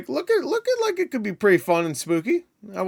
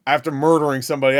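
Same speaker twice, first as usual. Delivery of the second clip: usual speaking voice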